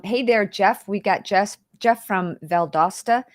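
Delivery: normal talking voice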